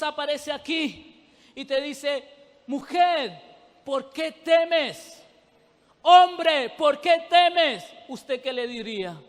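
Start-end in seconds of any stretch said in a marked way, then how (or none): 2.7-5.2 drawn-out, slow "¿por qué temes?"; Jesus calling the woman slowly; slow calling question. Acted. 6.0-7.8 loud, slow, raised "Hombre"; Jesus crying out to the man; loud calling question. Acted.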